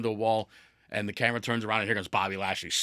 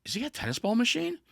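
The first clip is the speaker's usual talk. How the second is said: much higher, bewildered rising question; incredulous question; 13 semitones higher than usual. Retold or imitated. Host retells his reaction to the tennis balls, high and incredulous. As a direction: ask it in pure disbelief. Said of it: "And I'm like".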